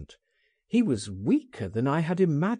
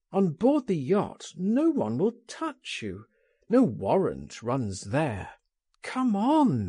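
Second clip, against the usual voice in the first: plain reading voice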